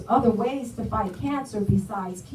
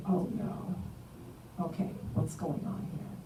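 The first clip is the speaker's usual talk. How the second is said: much softer, almost whispered worry; hushed dismay; 3 semitones lower, 12 dB quieter, slower than usual. Retold or imitated. To herself on finding a swollen lymph node, hushed dismay. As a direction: murmur it to yourself, dismayed.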